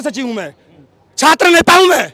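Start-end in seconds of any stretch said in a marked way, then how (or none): 1.2-2.1 loud, higher, forceful assertion; raised-voice self-assertion; defiant declaration. Real, not acted. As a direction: declare it proudly at full voice. Protester defiantly declares his position.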